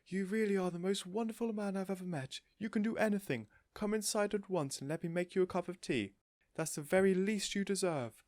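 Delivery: high, thrilled praise; lady overjoyed, praising her hero; gushing. A narrator's reading. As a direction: throw your arms around him. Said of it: "She flung her arms around him and cried out".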